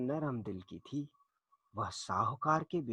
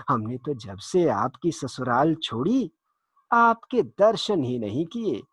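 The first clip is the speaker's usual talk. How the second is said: booming false cheer, louder throughout; fake hearty welcome; 3 semitones higher, 7 dB louder than usual. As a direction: play the sly thug overjoyed to see his jijaji. Cunning thug greets fake brother-in-law.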